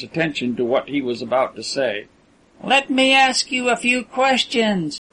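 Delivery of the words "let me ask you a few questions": higher, slow tall man's voice; a captive demanding to be heard; mild raised appeal from the tall man; crying out. A narrator's reading. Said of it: "he cried".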